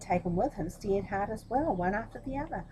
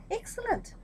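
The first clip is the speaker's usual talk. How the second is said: high, pleased exclamation; pleased emperor; 14 semitones higher than usual. Acted. Vain emperor delighted with his suit, high crow. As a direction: crow with self-satisfaction.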